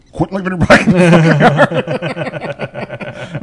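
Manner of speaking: dad laughing scold. Retold or imitated. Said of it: "he's like".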